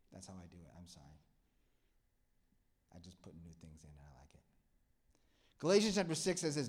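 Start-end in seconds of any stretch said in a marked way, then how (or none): 0.1-4.4 unvoiced confiding whisper. Real, not acted. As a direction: whisper it like a little secret.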